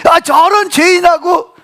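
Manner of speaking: high, drawn-out mock curse; retold cursing. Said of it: "저주합니다".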